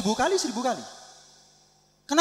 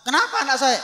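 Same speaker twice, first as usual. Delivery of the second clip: acted parental worry, raised pitch; mock-worried, higher voice; 6 semitones higher, faster than usual. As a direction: ask anxiously after your child. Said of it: "Saya khawatir".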